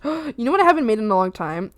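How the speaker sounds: ordinary talk, nothing marked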